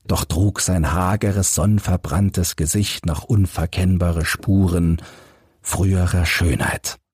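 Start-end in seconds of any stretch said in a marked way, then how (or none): none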